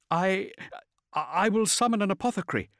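high, halting, worried woman; anxious high character voice; Cyrene, anxious. A narrator's reading. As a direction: stumble at the start, then hurry on high.

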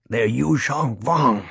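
slow, labored rasp. A narrator's reading. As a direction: rasp it out, dragging each word. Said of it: "Showalter rasped".

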